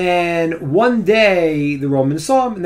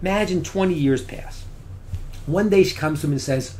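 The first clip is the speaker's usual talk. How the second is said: usual speaking voice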